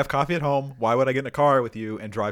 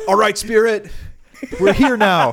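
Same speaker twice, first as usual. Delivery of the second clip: loud, high TV ghost hunter; 8 semitones higher, 8 dB louder than usual. Pretend. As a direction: call out to the spirit, TV host style.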